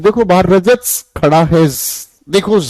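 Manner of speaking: long S tacked onto "रजत", "है" and "देखो"; mock plural S. Shown as a demonstration. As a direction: hang an exaggerated S on the ends of "रजत", "है", "देखो". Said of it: "हर चीज के पीछे एस या ईएस लगा दो".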